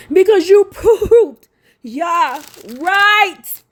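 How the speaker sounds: loud, high, slow wail of grief; crying out. Real, not acted. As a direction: wail it through real tears, drawing out each word. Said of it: "I gotta cry".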